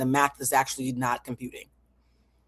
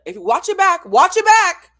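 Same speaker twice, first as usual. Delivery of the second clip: much higher and louder, insistent; high, loud insistence; rising exasperation; 13 semitones higher, 10 dB louder than usual. Real, not acted. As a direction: push up into a near-yell. Insisting, voice climbing.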